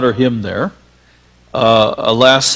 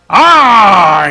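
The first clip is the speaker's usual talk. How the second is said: loud, high, booming poet's drawl; Rexroth's way of talking; 7 semitones higher, 9 dB louder than usual. Retold or imitated. Poet Rexroth greeting a young visitor, booming and high. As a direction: boom it out like the wild poet. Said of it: "he talked very much like this".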